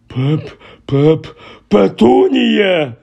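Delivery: choking stammer breaking into a high, slow cry; gasping. Acted. Uncle choking out his wife's name in shock, gasping. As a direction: stumble over her name, out of breath. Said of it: "аж задихнувся він".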